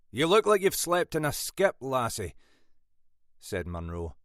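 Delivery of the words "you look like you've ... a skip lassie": a touch of a scolding, pitch raised; lightly voiced boss ticking off a junior. A narrator's reading. Boss to late sergeant, mildly scolding.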